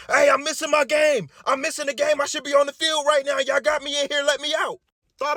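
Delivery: high, loud yelling at the desk; patient yells to the nurses at the desk, mock shouting; imitated yelling. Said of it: "He starts yelling at the desk".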